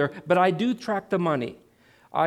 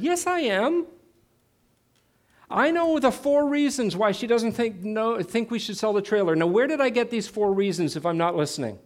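high, indignant comeback, easing down; defensive protest; 4 semitones higher than usual. Retold or imitated. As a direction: argue back as if wrongly accused. Argues back at the Lord, re-enacted.

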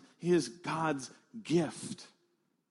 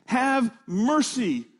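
higher, louder call of a blind beggar; crying out; 6 semitones higher, 5 dB louder than usual. A narrator's reading. Blind beggar cries out to Jesus from roadside, loud call. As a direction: call out across the crowd to be heard. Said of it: "he began to cry out and say".

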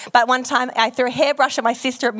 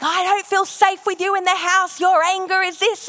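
upset sister; 7 semitones higher than usual. Retold or imitated. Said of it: "So she was like".

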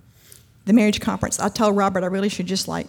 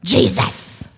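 high, loud desperate prayer, ending slow; an exhausted mother begging God through the stall door; frazzled mom praying; 7 semitones higher, 10 dB louder, slower than usual. Retold or imitated. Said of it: "she heard this female voice go".

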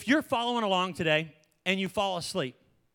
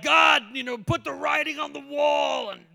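demanding prayer; 3 semitones higher than usual. Retold or imitated. Believer demanding miracles from God, exaggerated pleading. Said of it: "And we're like".

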